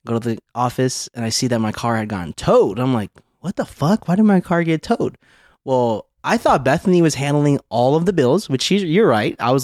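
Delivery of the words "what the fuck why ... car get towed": high-pitched, mildly baffled outrage; indignant disbelief. Retold or imitated. Husband retelling finding his car towed, mildly indignant. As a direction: re-enact being stunned and put out, pitch up.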